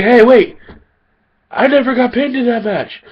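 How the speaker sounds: high-pitched, loud mock heel protest; whiny Batista. Batista complaining he never got pinned, high whiny heel voice. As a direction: play Batista as a whiny heel crying foul. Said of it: "Batista will come out and say".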